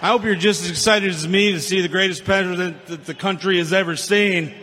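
ordinary talk, nothing marked

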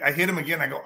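ordinary talk

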